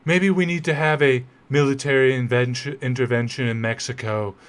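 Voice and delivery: a lightly done, badly faked blustering American politician voice; half-hearted mock bluster, tongue in cheek; terrible Trump impression. Lecturer mocking the president's Greenland talk with a terrible impression. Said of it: "I'm doing a terrible Trump accent".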